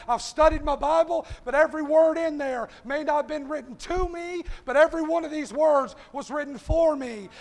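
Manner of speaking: rising sermon cadence with loud punched words; preaching. Acted. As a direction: call the church to stand in the hedge. Preaching the invitation to the church.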